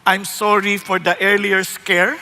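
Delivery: low, slow captain's announcement voice; mock pilot apologizing over the speakers; airline captain. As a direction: speak down and slowly like a pilot on the intercom. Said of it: "Sasabi nung kapitan".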